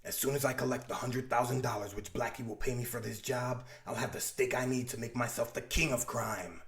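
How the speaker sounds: low, quiet scheming villain. Acted. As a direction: purr it low like a crime boss. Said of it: "He says".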